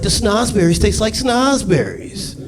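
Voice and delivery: put-on high, slow little-girl voice. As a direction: put on a high, drawn-out girl's voice. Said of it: "Little girl's like".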